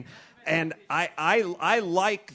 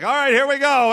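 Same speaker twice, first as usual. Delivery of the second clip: high, loud and gung-ho; cheerful rallying cry; eager followers; 11 semitones higher, 10 dB louder than usual. Pretend. Cowboys eagerly agreeing to follow the guide.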